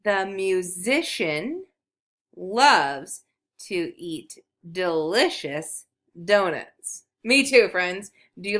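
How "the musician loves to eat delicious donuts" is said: drawn-out words for beginning readers to follow; slow model reading. Shown as a demonstration. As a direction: read slowly, word by word. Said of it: "This sentence says".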